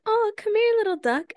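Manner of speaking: high sweet calling voice; princess voice. Retold or imitated. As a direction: coo to the duck like a princess. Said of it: "I was like".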